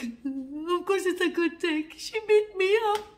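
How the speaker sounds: high, loud, slow wail; tearful, drawn-out retold protest; mock crying. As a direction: wail the complaint through acted tears. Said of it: "like, crying, like".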